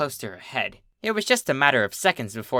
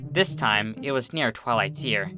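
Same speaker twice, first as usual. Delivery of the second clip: ordinary delivery, nothing marked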